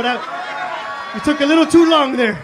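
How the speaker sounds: loud, high call-out; shouting at the crowd. Real, not acted. Calling out an audience member, loud. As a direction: yell it at the guy who hesitated.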